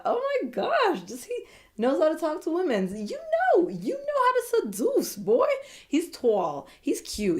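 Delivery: high, swooning squeal; giddy fangirl squeal; gushing excitement. Real, not acted. Gushing over a contestant.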